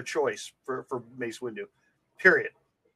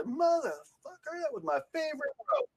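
Sam Jackson impression; 12 semitones higher, 6 dB quieter than usual. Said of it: "when he jumps off the thing".

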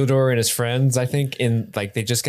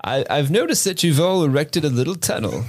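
higher, halting inquisitor; creepy orc papa; 4 semitones higher than usual. Acted. Papa questioning his children about a tunnel, mock puzzled. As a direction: probe it as a doubtful patriarch. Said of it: "he rolls out like".

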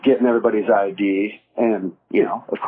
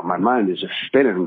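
normal talking voice

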